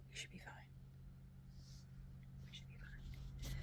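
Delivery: whispered self-reassurance. Shown as a demonstration. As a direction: reassure yourself in a hush.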